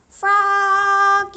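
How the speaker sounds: loud sung-out call across the house; high, loud call up the stairs; mother calling her child loudly. A narrator's reading. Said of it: "called his mother".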